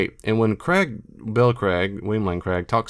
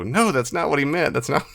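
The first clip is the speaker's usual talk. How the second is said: higher-pitched exasperated objection, played lightly; mildly frustrated; 6 semitones higher than usual. Listener objecting to a scholar being quoted, mildly frustrated. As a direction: protest in mock frustration, breaking off. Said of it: "I find myself very frustrated".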